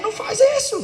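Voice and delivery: sharp high shout of não faz isso; mock yell at child. Father yelling at a child about to fall, mock shout. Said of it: "aí você vai gritar mesmo".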